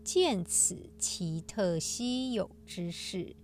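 slow, measured scripture reading; sutra recitation. Acted. Host reciting today's sutra passage to listeners, solemn recitation.

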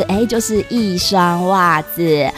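ordinary talk, nothing marked

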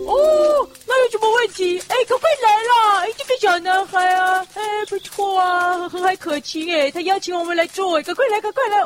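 excited. Acted. Eager to accept the invitation.

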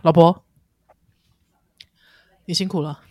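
low, tender husband voice; deep romantic husband line; handsome husband voice. Acted. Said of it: "我心中觉得帅的老公的声音应该要这样".

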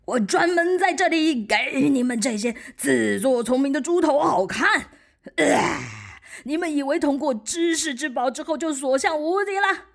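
loud, raging dragon bellow; huge fiery dragon roar. Acted. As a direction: roar it like a giant beast. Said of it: "巨龙再次咆哮".

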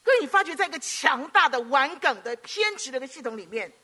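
preaching. Acted. Pastor warning the congregation about stubbornness, fervent preaching. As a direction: hammer each phrase home.

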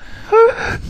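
very high short giggle; a quick high giggle; short laugh. Real, not acted. Guest giggles at the host's mock outrage, short high giggle.

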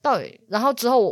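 usual speaking voice

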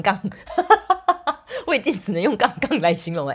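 embarrassed giggly rambling; laughing while talking. Real, not acted. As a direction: laugh at yourself for losing the word. Laughs at forgetting the word.

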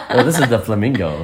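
ordinary delivery

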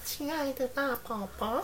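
put-on high, soft baby voice. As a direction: coo it like a little doll. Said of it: "假装自己是娃娃音".

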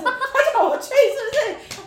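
high, loud question broken by laughter; laughing question. Real, not acted. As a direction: laugh it out while asking. Teasing friend, laughing.

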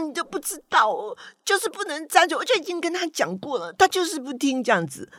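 high-pitched, aggrieved child voice, imitated; upset child. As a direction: play the sulky granddaughter insisting she was right. Child still upset, insisting.

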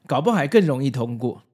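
ordinary talk, nothing marked

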